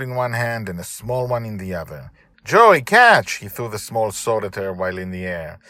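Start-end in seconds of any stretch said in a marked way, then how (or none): 2.4-3.3 high, loud call across the deck. Acted. Father shouts to Joy while tossing a sword, loud. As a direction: shout it as you throw. Father calls out mid-air.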